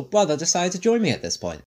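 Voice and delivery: ordinary delivery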